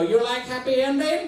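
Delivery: put-on high, rising foreign-accent question; caricatured broken-English accent; mock exotic accent. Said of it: "expected her to look at me and go".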